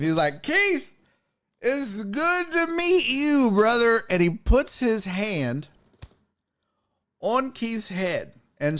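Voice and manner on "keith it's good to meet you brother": higher, slow overfriendly drawl; drunk guy voice. Retold or imitated. Drunk guest greets Keith while rubbing his head, higher slow drawl. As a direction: draw it out like a sloppy drunk buddy.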